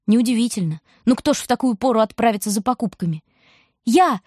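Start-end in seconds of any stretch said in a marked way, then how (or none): 3.8-4.2 higher, louder single cry; girl answers the question with a yell; shout. A narrator's reading. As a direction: call out over the storm. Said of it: "кричит Лампёшка".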